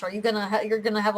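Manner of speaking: usual speaking voice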